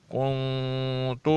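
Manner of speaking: کنت slowly, nun hidden in the nose; nasal ikhfa. Shown as a demonstration. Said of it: "اس پہ اخفا کیا جائے گا". (کنت میں اخفا، لمبی ناک کی آواز)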